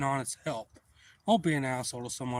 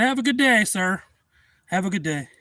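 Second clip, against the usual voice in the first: retold curt farewell; 6 semitones higher, 7 dB louder than usual. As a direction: call out a pointed goodbye. Sarcastic sign-off after quarrel.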